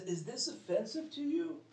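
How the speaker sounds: pitched well up with a searching lift; a pointed high question to the crowd; Jesus asking. Retold or imitated. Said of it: "Jesus says".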